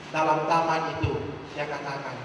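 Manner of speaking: normal talking voice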